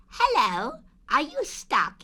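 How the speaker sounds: high, lively Rabbit voice; high fussy rabbit; Rabbit's voice. Acted. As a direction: pitch Rabbit up, brisk and fussy. Rabbit voiced in read story.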